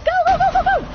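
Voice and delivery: very high-pitched rapid-fire urging; frantic urging. Real, not acted. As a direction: squeal it out in a hurry. Urging escape in game.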